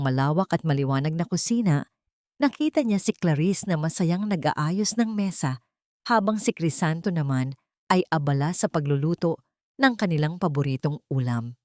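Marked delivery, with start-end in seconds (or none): none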